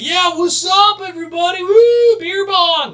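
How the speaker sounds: high, loud party bro whoop; party animal. Retold or imitated. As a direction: whoop it up like a frat party guy. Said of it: "strutting around being like".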